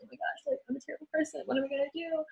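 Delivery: high, hushed, half-whispered despair; tearful panic. Retold or imitated. Crying in the bathroom after being called out, a high hushed wail. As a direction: whimper it out like a guilty student. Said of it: "I cried a little bit".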